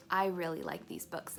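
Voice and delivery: ordinary delivery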